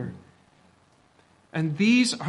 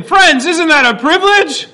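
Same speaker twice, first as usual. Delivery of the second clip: much louder and higher preaching; louder, higher, more energy; 11 semitones higher, 13 dB louder than usual. Shown as a demonstration. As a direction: really lift it, rally the room. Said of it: "That's better".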